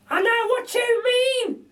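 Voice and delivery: very high, slow, chirpy lady voice; a cheeky cockney burglar lady; Burglar Betty. A narrator's reading. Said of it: "says Burglar Betty".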